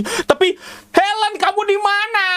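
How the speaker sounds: high desperate shout for Helen; acted crying call. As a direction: call out for a lost lover in anguish. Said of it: "Dia nangisnya gitu, guys. Eh, itu peragaannya ya".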